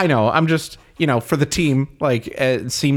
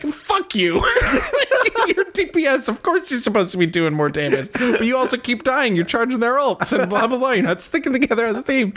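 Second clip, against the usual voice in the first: high-pitched laughing mock rant at the teammate; hammed-up outrage, words spilling through laughter; mock furious rant; 9 semitones higher than usual. Friend telling off the teammate, mock furious and laughing. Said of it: "And you're like".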